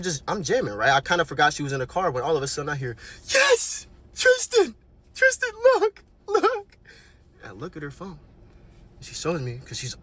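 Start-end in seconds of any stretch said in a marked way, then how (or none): 3.3-6.8 high, loud squeal of excitement; mimicking Lexi shrieking his name, much higher and louder; excited shriek. Mimicking Lexi as she shrieks for Tristan to look, excited squeal.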